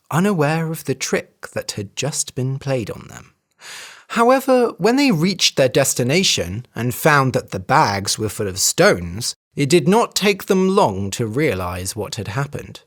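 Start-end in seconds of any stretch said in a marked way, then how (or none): none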